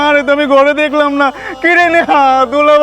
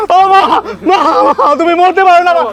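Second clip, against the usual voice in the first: wailing; 3 semitones higher than usual. Acted. Son refuses mother's death, wailing. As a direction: cry out that she cannot die.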